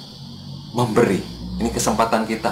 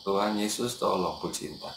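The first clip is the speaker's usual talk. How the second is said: soft, lowered quick prayer; hushed prayer, softer; inner prayer; 3 semitones lower, 9 dB quieter than usual. Retold or imitated. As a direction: say it as a silent plea to God. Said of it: "di dalam langsung ngomong".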